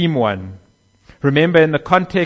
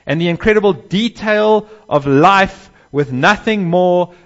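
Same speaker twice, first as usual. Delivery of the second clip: higher, louder preaching build on power; preaching at full force; 4 semitones higher, 4 dB louder than usual. Acted. Preacher stirring the congregation about God's power, full force. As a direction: drive the point home, rising with each powerful.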